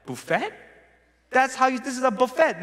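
high-pitched mock protest; defensive confusion. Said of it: "I was like".

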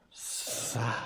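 breathy sad whisper. Shown as a demonstration. Contrasting happy and sad for the children, whispered sad. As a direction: let it fall away to a breath. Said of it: "Now, sad is like this".

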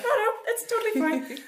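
high, laughing false calm. Retold or imitated. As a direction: giggle while insisting it's fine. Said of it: "You're like".

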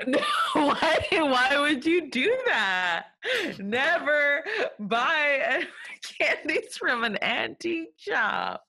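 high and laughing, half crying; laughing disbelief. Real, not acted. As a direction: laugh it out in mock horror.

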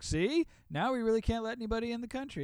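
high-pitched mock argument; mock vindication. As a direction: imitate a told-you-so in a high, rushed voice. Imitates opportunistic right-wingers.

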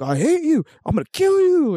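high-pitched wailing outrage; mock drunken threat. Drunk man smacking his brother with a phone, mock fury. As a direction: squeal the threat like a sloppy drunk.

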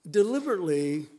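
ordinary talk, nothing marked

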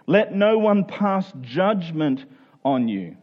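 ordinary delivery

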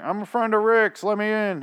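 high, loud mock holler; calling out. Morgan knocks at the compound door, calling out to be let in. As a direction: call through a shut door, pitch jumped up. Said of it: "knocking on the door".

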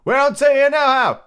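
loud, very high yell back; shouted reply. A narrator's reading. Man answering from the window, shouting high. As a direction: holler it back down.

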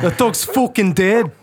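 broad comic Irish, drawn out; mock Irish accent. Passer-by bluntly declaring the dog dead, mock Irish accent.

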